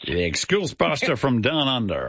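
hammed-up American announcer; big mock American stage introduction; American accent. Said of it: "in your best American accent".

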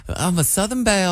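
soft mock Southern belle accent. Host teasing co-host with a mock Southern belle accent. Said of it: "you turn on that Southern accent".

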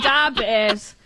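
slow, hammed-up Chicago accent on da Bears; mock Chicago superfan saying da Bears. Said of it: "I guess I can do my Chicago".

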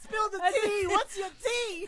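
high, through laughter; laughing. Real, not acted. Guest teasing the host about tea, laughing. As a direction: laugh your way through the words.

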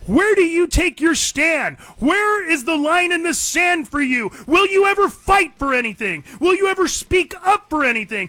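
high, loud fiery preaching; rally the listeners, loud and slow; impassioned rant. Real, not acted.

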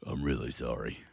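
low, soft blokey tradie; deep tradie voice. Retold or imitated. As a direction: drop into a deep tradie mumble. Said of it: "as if your voice isn't deep already".

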